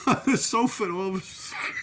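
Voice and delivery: words breaking into laughter; real laugh in the words; laughing while talking. Real, not acted. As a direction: start cracking up as you tell it. Cracks up before the punchline.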